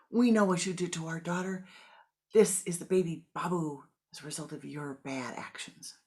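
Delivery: loud, hissing fury at the monk; furious accusation. Acted. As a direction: spit the insult at him.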